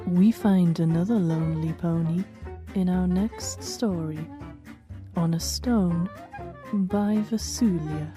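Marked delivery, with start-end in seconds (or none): none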